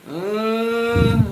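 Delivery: long, held, sceptical hum; doubtful drawn-out "uhh". Shown as a demonstration. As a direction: draw out a wavering "uhhh" of doubt. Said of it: "I do my face like this".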